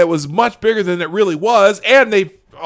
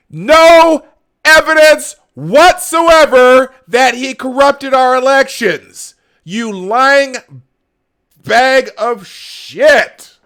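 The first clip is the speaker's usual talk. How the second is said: slow, high, outraged shout; furious high-pitched holler; real yelling; 7 semitones higher, 8 dB louder, much slower than usual. Real, not acted. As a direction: yell the accusation, drawing out each word. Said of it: "excuse me for yelling and swearing".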